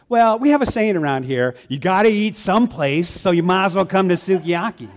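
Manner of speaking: mock slogan. Church member pitches sukiyaki to a doubter, loud mock slogan.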